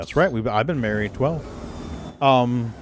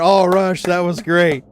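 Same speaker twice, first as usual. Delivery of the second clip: excited; 5 semitones higher, 4 dB louder, slower than usual. Real, not acted. Praising friend's joke.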